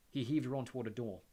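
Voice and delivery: plain reading voice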